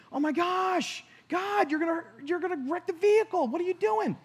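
high, alarmed pleading at God; frantic, sure the car gets wrecked; panicked cry. Retold or imitated. Watching cars swerve at his SUV, high panicked cry.

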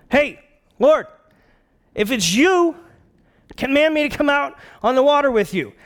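high, loud call with pauses; bold Peter. Acted. Peter calls from the boat to Jesus, bold shout. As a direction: call out boldly across the water. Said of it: "being the bold one".

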